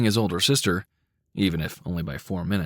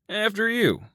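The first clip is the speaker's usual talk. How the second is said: high, polite male character voice; lightly voiced gentlemanly brother; 12 semitones higher than usual. A narrator's reading. Brother holds door open.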